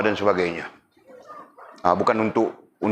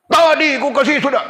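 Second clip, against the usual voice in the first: shrill, loud, high-pitched snapping complaint; angry beggar's retort; 7 dB louder than usual. Retold or imitated. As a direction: mimic an irritated man who already gave. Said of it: "Nah, marah begitu". (marah, suara tinggi dan kuat)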